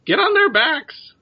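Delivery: high, loud, drawn-out order; bird leader's command. Acted. Bird leader Zoltan orders adventurers to mount up, high loud creature voice. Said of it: "and it says".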